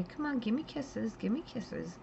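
high, soft coo to a pet lizard; coaxing pet talk. Retold or imitated. Owner cooing to her bearded dragon, high and soft. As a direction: sweet-talk the dragon for a kiss. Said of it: "you say".

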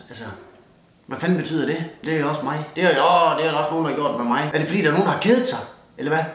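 normal talking voice

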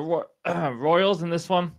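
hoarse, fading voice; losing voice. Real, not acted. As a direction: voice giving out, throat cleared. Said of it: "I'm losing my voice right at the end".

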